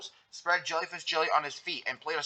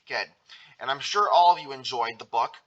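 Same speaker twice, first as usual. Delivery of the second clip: usual speaking voice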